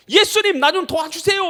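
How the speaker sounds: high, loud plea for help; acted shout. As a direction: cry out like a blind beggar pleading. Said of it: "소리 지르는 것밖에 없는 거예요". (도와달라고 크게 외치는 소리)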